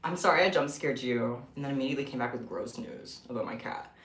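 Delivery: ordinary talk, nothing marked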